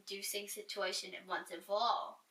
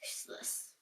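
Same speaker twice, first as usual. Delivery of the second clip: dry, whispered verdict; a whispered one-word put-down; whispered aside; 10 dB quieter than usual. Acted. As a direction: breathe it like a deadpan aside.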